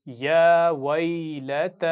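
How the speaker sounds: drawn-out, melodic tajweed recitation; slow Quran recitation. Shown as a demonstration. Teacher reciting slowly for pupils to repeat. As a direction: chant each syllable slowly for the class to copy. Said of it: "आप गौर से सुनिए और दोहराइए".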